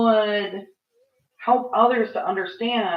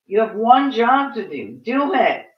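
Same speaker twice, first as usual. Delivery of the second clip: exasperated, louder demand that climbs higher on the last words; angry; 4 semitones higher, 5 dB louder than usual. Real, not acted. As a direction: snap the order at people who won't do their job. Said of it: "I'm angry".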